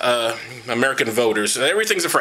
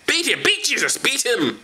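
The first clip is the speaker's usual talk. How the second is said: much higher, jeering chant; mock mob; 8 semitones higher, slower than usual. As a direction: egg on the beating like a crowd. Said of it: "Whip, whip".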